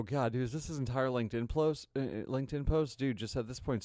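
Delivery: ordinary talk, nothing marked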